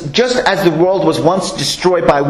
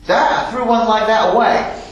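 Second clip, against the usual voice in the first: higher, sneering scoffer voice; mocking scoffer; 6 semitones higher than usual. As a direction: scoff at the bicycle like a know-it-all. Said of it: "And they'll just mock you".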